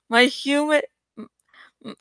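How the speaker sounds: ordinary delivery, nothing marked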